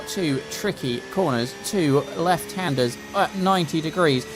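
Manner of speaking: normal talking voice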